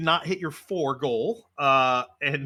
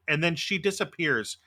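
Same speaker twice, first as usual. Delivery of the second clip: ordinary talk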